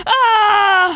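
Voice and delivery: acted yell from a sudden stab; loud cry. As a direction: let out a big high "aah". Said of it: "qui poussa un grand cri".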